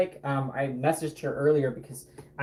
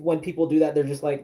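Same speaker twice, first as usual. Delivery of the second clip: normal talking voice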